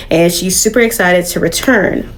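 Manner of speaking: ordinary talk, nothing marked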